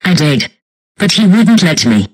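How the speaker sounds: loud, frustrated protest; upset self-defence. Acted. Blamed for failing to get in, loudly defensive.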